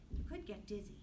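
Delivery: ordinary talk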